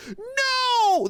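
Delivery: long, very high, loud "no"; drawn-out squealing yell; boy's scream. A narrator's reading. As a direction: scream it out like a kid. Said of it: "the boy screams".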